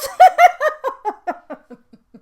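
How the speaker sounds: very high, loud peal of laughter; real laugh. Real, not acted. Speaker recalls putting head back down, high real laughter. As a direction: burst out in a helpless cackle. Laughing at her own retold memory.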